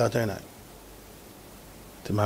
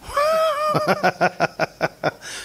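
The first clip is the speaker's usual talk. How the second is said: high laugh. Real, not acted. Laughs at his own joke.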